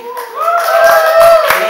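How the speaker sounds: shrill high okay squeal. Acted. Hostess persona squeals to crowd.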